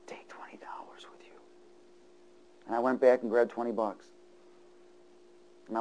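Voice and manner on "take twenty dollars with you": whispered inner voice. Retold or imitated. Preacher voicing the Spirit's whisper about twenty dollars. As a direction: breathe it like a quiet prompting. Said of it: "I heard him say".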